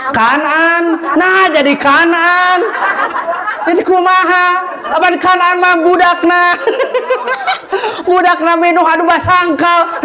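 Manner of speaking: high, laughing teasing; preacher teasing a lady who guessed wrong, laughing; laughing banter. Real, not acted. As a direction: tease the lady through laughter. Joking with audience member.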